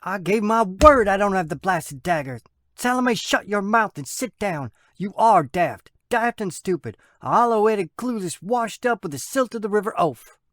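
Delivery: high, heated brother's outburst; angry Samuel. Acted. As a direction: lash out at sister and skipper. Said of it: "slammed his paw down on the table".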